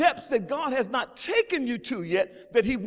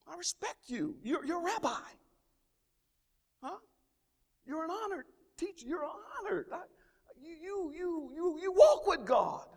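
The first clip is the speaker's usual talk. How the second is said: softer, higher reverent voice; hushed admiring Nicodemus; humble soft address; 6 semitones higher, 8 dB quieter than usual. Acted. Nicodemus voiced softly to Jesus.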